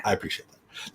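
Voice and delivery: soft breathy whisper of thanks. Real, not acted. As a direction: whisper "I appreciate that" to the guest. Thanks guest, then repeats whispered.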